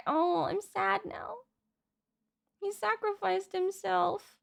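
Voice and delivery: ordinary talk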